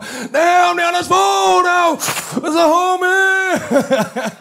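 high, loud mock yell, last words slow; excited bandmates. Bandmates spotting the politician, yelling a high excited greeting. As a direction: hail him like a star-struck fan. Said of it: "Så kommer Nieren og Johnson op".

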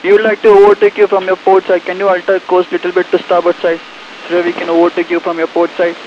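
marine radio. Real, not acted. Ship calls over radio.

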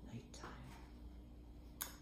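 hushed night whisper; soft spooky nighttime whisper. Acted. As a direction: breathe it like it's bedtime. Night spoken softly.

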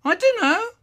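very high, loud, rising innocence; squeaky denial. Acted. Boy lying to dad, squeaky innocence. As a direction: squeak it, eyebrows-up liar. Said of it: "And I go".

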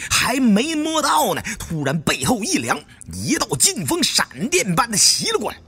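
ordinary delivery

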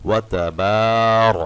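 তাবার with the বা drawn one alif; slow, loud তাবার; stretched ba. Shown as a demonstration. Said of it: "বাতে একটা টান". (বাতে এক আলিফ টান)

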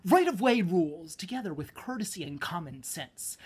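loud, declamatory slam-poem delivery. Pretend. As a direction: belt out the opening like a slam poet. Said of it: "I'm supposed to do it like a slam poem".

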